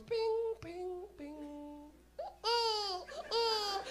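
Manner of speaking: put-on high pinging chime then baby whimper; imitated chime and cry. Comic mimicking the subway chime and his fake baby cry.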